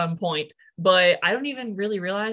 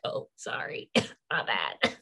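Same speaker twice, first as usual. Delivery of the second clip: sheepish apology; 8 semitones lower, 6 dB quieter, slower than usual. Retold or imitated. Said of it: "I'm like".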